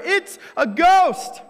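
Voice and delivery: high, loud, slow shriek of terror; terrified scream. A narrator's reading. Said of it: "their first response is in terror".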